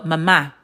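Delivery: mama with the accent on the last syllable, ma-MA; stress on second syllable. Shown as a demonstration. Teacher sums up the accent mark rule, mama stressed ma-MA. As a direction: lean on the end of mama: ma-MA. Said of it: "you emphasize that syllable".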